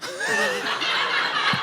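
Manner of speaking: silent wheeze laugh. Real, not acted. Laughing at heckler story.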